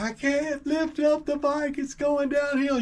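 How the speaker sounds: put-on very high, slow kid whine; drawn-out, squeaky mock wail of a kid; imitating upset child. Kid calling up to dad, high mock wail. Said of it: "I tell him".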